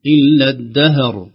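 الدهر with a vowel slipped onto the ha; loud, careless إلا الدهر with the ha moved; wrong vowelled ha. Shown as a demonstration. Teacher demonstrating the mistake in الدهر. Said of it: "لو لم نعتني سنقول".

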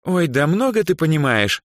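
high, mocking comeback. A narrator's reading. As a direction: brush her off derisively. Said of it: "насмешливо ответила Даяна".

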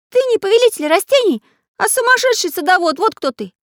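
high, loud cartoon outburst; shouted retort. A narrator's reading. Pencil character insults plant ruler, shrieked defiance. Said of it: "выкрикнул Карандаш".

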